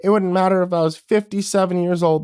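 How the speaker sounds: tearful pleading. A narrator's reading. Boy pleading, near tears.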